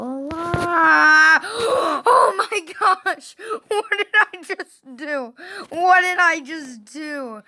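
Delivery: high, loud gasp and amazed cry; stunned disbelief. Real, not acted. Gamer survives by luck, stunned disbelief. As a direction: gasp and marvel at what just happened.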